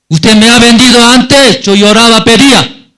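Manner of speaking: high, loud wounded fury; bitter accusation. Retold or imitated. Joseph reproaching brothers who sold him, loud and high. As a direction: hurl the old betrayal back at them.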